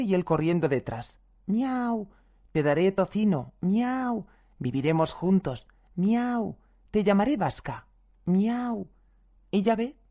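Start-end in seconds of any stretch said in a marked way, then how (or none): 1.5-2.1 high, falling meow; cat meow. Acted. A stray cat answers the old woman with a high meow. 3.6-4.3 high, falling meow; cat meow. Acted. A stray cat answers the old woman with a high meow. 6.0-6.6 high, falling meow; cat meow. Acted. A stray cat answers the old woman with a high meow. 8.2-8.9 high, falling meow; cat meow. Acted. A stray cat answers the old woman with a high meow.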